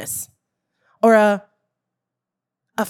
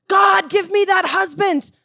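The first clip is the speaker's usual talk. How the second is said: higher and louder, a whiny demand to God; mock demanding prayer; 6 semitones higher, 3 dB louder than usual. Preacher mocks a lazy believer's prayer, a whiny demand. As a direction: pray like you expect it handed to you.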